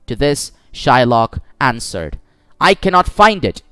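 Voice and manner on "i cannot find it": higher-pitched, insistent denial; firm refusal. A narrator's reading. Shylock answering the lawyer's plea, firm refusal.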